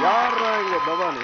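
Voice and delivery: slow, loud call-out; loud challenge. Acted. Comic rival demanding who the named man is, loud challenge. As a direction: draw out the challenge and project it. Acted challenge, calling out a name.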